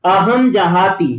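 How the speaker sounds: अहम जहाती drawn out syllable by syllable; slow clear reading. Shown as a demonstration. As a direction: spell out अहम जहाती for the class. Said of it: "उन्होंने भी यहाँ भी देख लिया".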